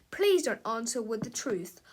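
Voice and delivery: ordinary delivery, nothing marked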